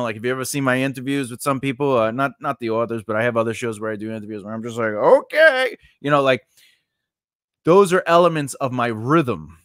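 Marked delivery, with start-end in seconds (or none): 4.9-5.8 loud, high-pitched drawn-out reaction; hammed-up high, loud interjection; exaggerated okay. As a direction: jump way up in pitch and hold it. Imitating own interview reaction.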